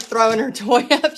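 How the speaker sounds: ordinary delivery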